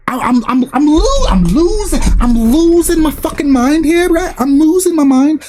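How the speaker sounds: losing his mind. Real, not acted. Streamer telling chat he is losing it, real exasperation. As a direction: stumble over the start, then stretch out the frustration. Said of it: "I'm actually losing my mind".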